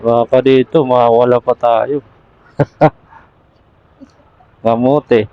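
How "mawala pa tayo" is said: usual speaking voice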